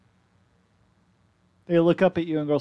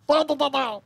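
high chirpy pebble babble; creature gibberish; 10 semitones higher than usual. Acted. Pebble chatters to the others, high babble. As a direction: chatter like a tiny rock creature.

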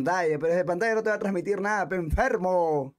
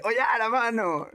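higher, drawn-out mock exclamation; 5 semitones higher than usual. Streamer retelling the rapper's comeback, higher slow mimicry. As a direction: stretch it out as a teasing impression. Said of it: "y [name] dice".

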